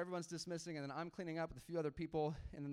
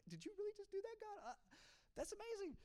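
high, softened voice of amazement; hushed disbelief; 8 dB quieter than usual. Retold or imitated. Pastor retelling his doubting question to God, hushed disbelief. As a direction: act out marveling privately at God.

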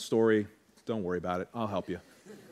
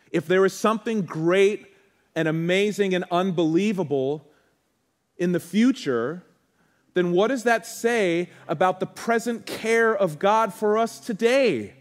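higher and louder, preaching with rising fervour; impassioned preacher pleading with the congregation, higher and louder; full-force preaching; 10 semitones higher, 7 dB louder than usual. Acted. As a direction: build the sermon to a passionate climax.

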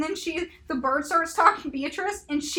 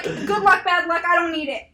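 loud, raised mock retort; retold dismissal; 3 semitones higher, 6 dB louder than usual. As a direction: act out the tavern lady brushing it off. Acts out tavern lady's dismissal.

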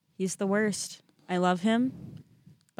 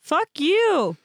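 playful curse at cat; 9 semitones higher, 5 dB louder than usual. Pretend. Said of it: "we have conversations like this".

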